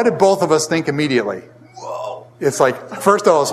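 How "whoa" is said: breathy hushed whoa, retold shock; whispered whoa. Brothers realizing the knife is stuck, whispered whoa. What both brothers thought.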